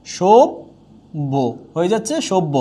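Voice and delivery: সভ্য said slowly as "শোব্ভো", doubled ব; slow model pronunciation. Shown as a demonstration. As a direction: stretch সভ্য out, doubling the consonant. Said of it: "তাহলে এই সভ্য হয়ে যাবে কি?".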